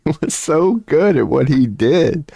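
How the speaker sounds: laughing. Real, not acted. Laughing praise of Bernie Mac.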